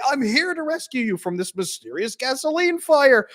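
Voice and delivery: high, grand rescuer voice; a mock valiant rescuer; hammed-up hero. Acted heroic rescuer bursting in.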